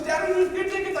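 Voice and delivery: put-on very high, pleading child voice; imitating young daughter. Daughter begging her dad, high and pleading. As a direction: plead like a nine-year-old.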